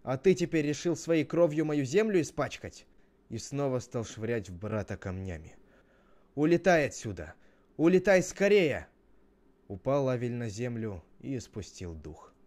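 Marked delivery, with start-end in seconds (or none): 0.0-2.9 loud, high, outraged question; acted angry outburst; shouting. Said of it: "стал ещё громче кричать". 6.4-8.9 loud, high angry yell; furious storybook shout. Acted. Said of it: "стал ещё громче кричать".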